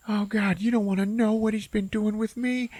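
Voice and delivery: high, plaintive character voice; hammed-up high anguish; traumatized voice. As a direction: pipe up high in mock distress. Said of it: "hear this one voice go".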